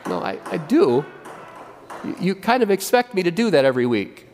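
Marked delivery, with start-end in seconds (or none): none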